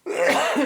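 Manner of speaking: short dry cough; a quick rasping cough. Real, not acted. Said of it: "Excuse-moi, je tousse un peu".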